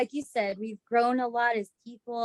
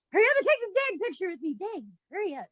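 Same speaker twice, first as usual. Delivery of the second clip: higher, fast, mock-impatient rush that softens at the end; impatient quote; 7 semitones higher, faster than usual. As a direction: hurry them along, half joking. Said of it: "it was like".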